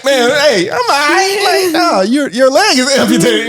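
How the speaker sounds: high, hammed-up I'm-fine voice; mock aunt. Aunt claiming she's fine after the fall, high mock voice. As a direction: brush off a terrible fall.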